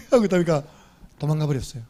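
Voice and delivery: ordinary delivery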